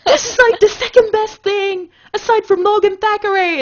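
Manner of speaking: high, loud gushing; fangirl squeal. Retold or imitated. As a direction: squeal like an excited fan. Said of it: "And I'm like".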